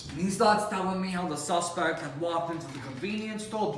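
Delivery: ordinary delivery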